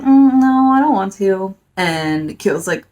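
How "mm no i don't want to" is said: high, loud pouty no; whiny refusal. Retold or imitated. Girl refusing a boy's dinner invitation, pouty. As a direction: turn him down like a sulky teen.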